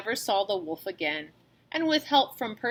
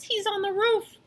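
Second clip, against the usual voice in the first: much higher scared warning; frightened; 11 semitones higher than usual. A narrator's reading. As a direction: point up in alarm. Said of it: "shivered Horace".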